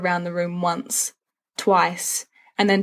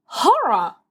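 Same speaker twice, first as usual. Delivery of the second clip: hammed-up cry of horror, higher; mock horror; 7 semitones higher than usual. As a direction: gasp it out like a scandalised priest.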